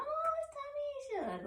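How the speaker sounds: soft, high, drawn-out murmur; retold groggy little voice; drowsy recognition. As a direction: say it slowly and softly, high up, as if half asleep. Groggy waking, spots cousin.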